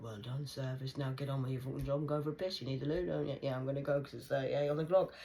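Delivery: usual speaking voice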